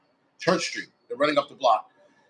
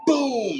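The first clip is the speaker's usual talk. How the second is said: high-pitched imitated boom; booming crash; 11 semitones higher than usual. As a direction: let out a high, sudden boom. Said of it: "I hear something go".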